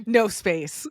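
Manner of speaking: said through real laughter; laughing while speaking. Real, not acted. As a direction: giggle out the punchline. Laughing at David's behavior.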